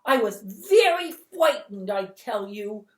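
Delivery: put-on high, loud, slow child's yell. Twinkle yelling his innocence from far off, high and loud. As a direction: yell it like an indignant little boy. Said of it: "Twinkle was yelling".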